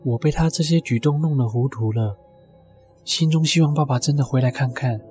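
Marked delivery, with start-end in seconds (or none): none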